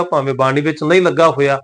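ordinary talk, nothing marked

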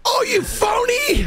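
mock shout. Mocking a game character.

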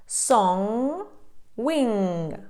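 "song" and "wing" ending in a nasal NG, no hard G; carefully separated model words, "song", "wing". Shown as a demonstration. Teacher giving examples of NG, nasal ending. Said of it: "це носовий звук н".

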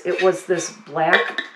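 normal talking voice

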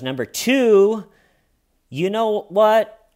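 high, loud, drawn-out mock announcement; imitated emphatic declaration. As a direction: stretch each phrase, high and loud, imitating her. Suspect announces her second reason.

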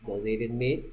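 ordinary talk

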